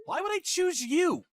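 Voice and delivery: high-pitched, scornful disbelief; incredulous rejection. Acted. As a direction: throw the question back, voice shooting up. Ex rejects pleading former wife.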